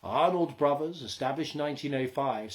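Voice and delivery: plain reading voice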